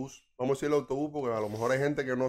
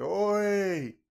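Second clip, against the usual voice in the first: higher, louder drawn-out call; negrón de la guagua; 8 semitones higher, 5 dB louder than usual. Acted. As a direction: call out to the whole bus. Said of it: "Y dice". (vozarrón de negrón llamando, alargado)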